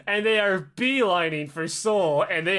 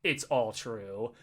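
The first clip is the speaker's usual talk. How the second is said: grave quiet admission; 8 semitones lower, 7 dB quieter, much slower than usual. Retold or imitated. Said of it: "and he says".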